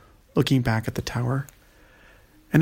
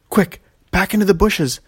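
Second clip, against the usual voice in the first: urgent, raised command; 6 semitones higher, 5 dB louder than usual. Acted. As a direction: bark the order to hide. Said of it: "Nea commanded".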